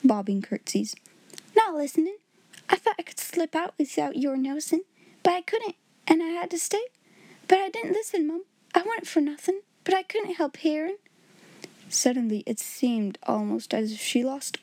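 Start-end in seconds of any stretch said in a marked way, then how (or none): 1.6-11.0 high, timid maid voice; a frightened little servant girl pleading; Becky's voice. A narrator's reading. Becky denying she eavesdropped.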